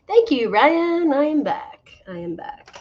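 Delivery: high, slow, proud return announcement; hammed-up triumphant I am back; grand announcement. Real, not acted. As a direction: announce your comeback like a host.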